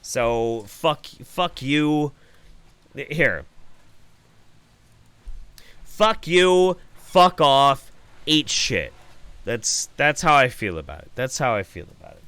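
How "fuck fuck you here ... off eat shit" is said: angry cursing. Real, not acted. Cursing out anti-vax viewers.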